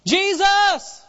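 high, loud shout of the name; acted beggar's loud cry. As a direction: holler it out over a crowd. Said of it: "they holler all the louder".

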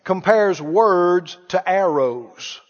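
slow repeat. Shown as a demonstration. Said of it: "Have you read it?".

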